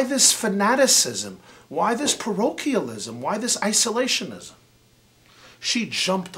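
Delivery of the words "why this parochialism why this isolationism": usual speaking voice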